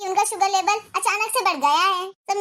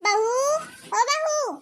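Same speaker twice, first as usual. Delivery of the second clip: higher, drawn-out call to someone in another room; sing-out summons; 5 semitones higher, much slower than usual. Acted. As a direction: summon her with a lifted, stretched call. Mother-in-law calls out to daughter-in-law.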